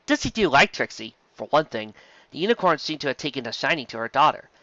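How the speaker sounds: usual speaking voice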